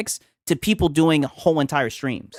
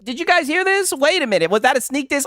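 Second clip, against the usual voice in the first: high, hammed-up shock at a diss; mock outrage; 7 semitones higher than usual. Real, not acted. Streamer to chat, hammed-up outrage. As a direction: act scandalized for the stream.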